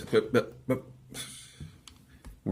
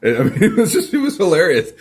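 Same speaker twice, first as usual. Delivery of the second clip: laughing; 9 semitones higher, 3 dB louder than usual. Real, not acted. Laughing at memory.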